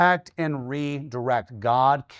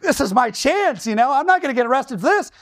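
eager hero fantasy; 9 semitones higher, 3 dB louder than usual. Retold or imitated.

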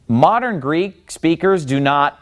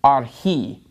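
"archi" with the weight on "chi"; stress on last syllable. Shown as a demonstration. Teacher pointing out the accent, final stress on "archi". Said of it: "the accent is on the last syllable in this word".

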